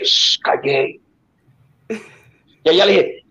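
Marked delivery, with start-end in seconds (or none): 0.0-1.0 low whispered shush; hushing whisper. Retold or imitated. Pulling her aside and whispering low to hush her. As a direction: hush her close in a corner.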